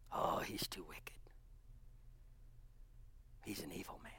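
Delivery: hushed, breathy whisper of doubt; whispered gossip. Acted. Disciples muttering about Paul, whispered. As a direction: whisper it like a suspicious aside.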